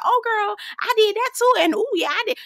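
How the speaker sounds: put-on high, sugary one-upping girlfriend; mock a fake friend bragging back; mimicking a phony friend one-upping you. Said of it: "they trying to be like".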